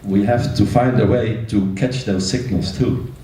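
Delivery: usual speaking voice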